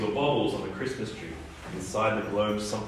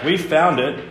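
higher, louder shouted claim; defiant shout; 5 semitones higher, 5 dB louder than usual. A narrator's reading. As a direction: shout it back at the giant island. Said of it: "Iris shouted back".

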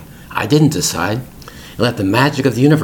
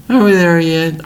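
higher, louder whine; impatient kid; 8 semitones higher, 4 dB louder than usual. Retold or imitated. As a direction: pitch it up like an impatient child. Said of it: "those expressions from our kids".

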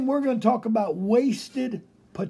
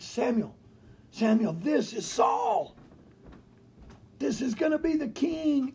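excited God; 6 semitones higher than usual. Pretend. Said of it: "God was excited".

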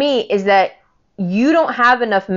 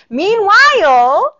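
drawn-out meanwhile; 10 semitones higher, 5 dB louder, much slower than usual. Real, not acted. First of two meanwhiles.

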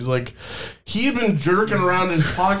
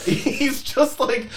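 laughing; 7 semitones higher, much slower than usual. Real, not acted. Laughing over telling.

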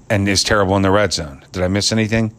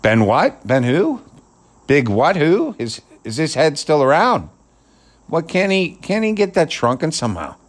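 mocking confused fan, pitched higher; 7 semitones higher than usual. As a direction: sneer like a baffled fan. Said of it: "people could be saying".

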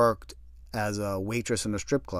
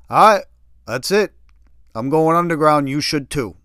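loud, higher frantic declaration; panicked shouting; 4 semitones higher, 10 dB louder than usual. Retold or imitated. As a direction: bark it out before slamming the phone. Kev panics, hangs up.

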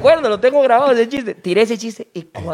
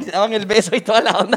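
ordinary delivery

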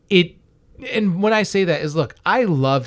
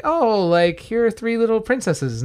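put-on higher, sweet, doting tone for the girls; playful grandfather voice; 6 semitones higher than usual. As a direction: coo it like a grandpa charmed by kids. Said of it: "And he's like".

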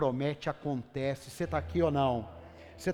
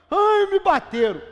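put-on much higher, louder childish whine; whiny crying child; 14 dB louder than usual. Child telling the parent they got hit, high whine. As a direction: whimper like a hurt kid running home.

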